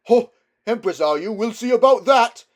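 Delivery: loud, high jeering shout; mocking heckler. A narrator's reading. Heckler in the crowd jeering at the witch, loud mocking shout. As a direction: jeer at her, full voice.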